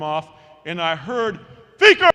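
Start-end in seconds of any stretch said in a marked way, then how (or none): 1.8-2.1 sudden high, booming shout; full-voiced coach's holler; coach calling out. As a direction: yell it across a field to catch a runaway. Coach calls out to the boy.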